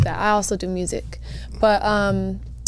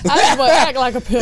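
laughing; 3 semitones higher, 4 dB louder than usual. Real, not acted. Laughing while joking.

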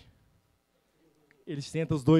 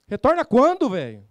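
cobrança alta e insistente; pressing demand; 7 semitones higher, 8 dB louder than usual. Acted. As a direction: aperta o cliente em voz alta. Pressing the client on the phone. (vendedor cobrando o cliente, bem mais alto)

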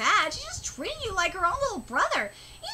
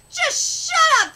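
loud, slow, high crying shout; exasperated yell; 8 semitones higher, 6 dB louder, slower than usual. Real, not acted. Viewer yelling at a gushing character, exasperated.

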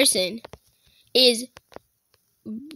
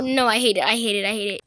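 ordinary talk, nothing marked

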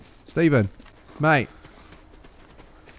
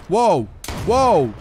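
startled whoa; 2 semitones higher, 4 dB louder than usual. Real, not acted. Startled by sudden danger.

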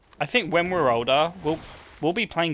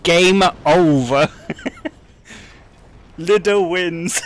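slow, loud, higher announcer call; mock game-over announcer; 5 semitones higher, 8 dB louder, slower than usual. Streamer mock-announcing the game over to chat, slow and loud. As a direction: boom it out like a game's end screen.

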